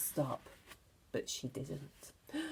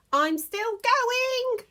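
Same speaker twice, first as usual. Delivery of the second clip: put-on high, slow screechy old woman's voice; Grandma calling from the attic in a screechy voice; 14 semitones higher, 5 dB louder, much slower than usual. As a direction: stretch it out like a cackling old crone. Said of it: "the old screechy voice from up above".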